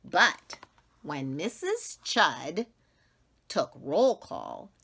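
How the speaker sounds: ordinary delivery, nothing marked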